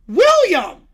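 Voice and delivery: sharp, high yell of the name. Acted. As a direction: mom calling her son angrily. Mom yelling son's name.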